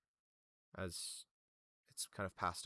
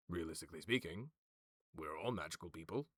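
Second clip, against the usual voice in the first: low-pitched, casual persuasion; reasoning schemer; 4 semitones lower, 3 dB quieter than usual. Acted. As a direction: make it sound perfectly reasonable. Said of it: "He kind of looks around and goes".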